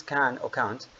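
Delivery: American accent, "can or can't" with the flat a of cat, "can't" ending in a clear t. Shown as a demonstration. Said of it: "in American accent is".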